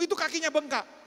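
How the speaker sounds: put-on man loudly claiming the boy's leg is swollen; loud insisting. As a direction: push the claim hard. Man exaggerates injury loudly.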